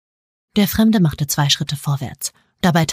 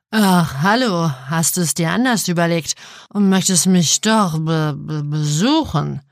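put-on slurred drunken cooing voice; slower than usual. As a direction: coo it like a tipsy flirt. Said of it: "gurrte er".

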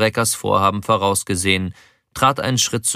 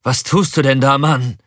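higher, louder desperate demand; raised voice with despair creeping in; 5 semitones higher, 5 dB louder than usual. A narrator's reading. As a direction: plead in rising desperation. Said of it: "In Breckers Stimme schwang bereits Verzweiflung mit".

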